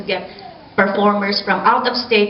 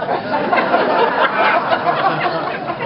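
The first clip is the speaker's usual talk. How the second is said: airy laugh; 10 semitones higher than usual. Real, not acted. Laughs at Catholicism joke.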